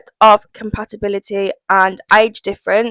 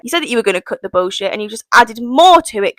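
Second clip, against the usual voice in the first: usual speaking voice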